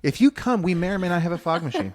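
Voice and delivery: excited. Real, not acted. Hyping the fog machine.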